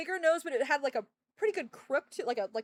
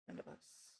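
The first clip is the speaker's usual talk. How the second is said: whispered despair; 20 dB quieter than usual. Real, not acted.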